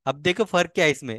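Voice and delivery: ordinary talk, nothing marked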